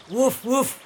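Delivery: high doggy woof-woof. Acted. Old dog agreeing to join the donkey, woofing.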